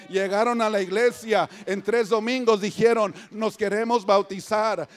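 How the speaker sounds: loud, emphatic testimony from the pulpit; preach. Real, not acted. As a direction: proclaim it to the congregation. (predicando con voz fuerte)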